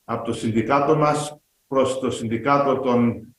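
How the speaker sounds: usual speaking voice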